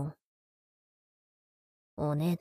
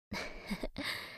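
very soft, breath-only snicker; quiet chuckle; 13 dB quieter than usual. Acted. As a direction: snicker under your breath. Amused at sister's imagined embarrassment. (息だけの忍び笑い)

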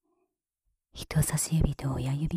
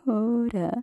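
ordinary talk

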